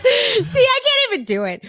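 high laughing admission; giving up, cracking up. Real, not acted. Cracking up mid read.

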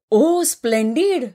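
high, slow mock delighted squeal; lightly acted gushing cry; excited shriek. A narrator's reading. Friend gushing over the groom's photo, mock squeal. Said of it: "Sulu किंचाळली".

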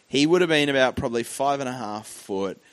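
ordinary delivery

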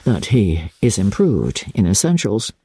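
dropped pitch, grave delivery; low and sober. A narrator's reading. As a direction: sink into seriousness. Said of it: "in a lower and more serious tone".

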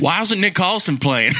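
put-on Southern accent. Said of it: "in a Southern accent".

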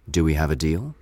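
ordinary talk, nothing marked

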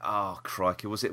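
usual speaking voice

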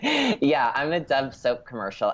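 usual speaking voice

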